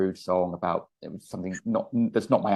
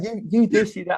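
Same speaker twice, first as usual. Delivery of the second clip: mock ugly argument; 10 semitones higher than usual. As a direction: imitate two men swearing at each other, pitched right up. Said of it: "it got really ugly".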